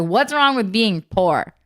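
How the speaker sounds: loud, emphatic telling-off, slow then punchy; going off on child. Retold or imitated. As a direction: re-enact an indignant scolding of a child. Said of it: "went off on Anna".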